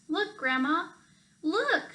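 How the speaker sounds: put-on high, rising call of children; children calling Grandma to see the bird, high; excited shout. A narrator's reading. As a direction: point and call out eagerly. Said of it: "shouted the children".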